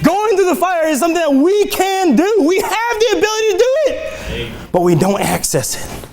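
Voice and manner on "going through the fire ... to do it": high, driving preacher delivery; impassioned sermon voice, pitched up; fervent preaching. Real, not acted. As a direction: preach it with rising fervour. Preaches at full force.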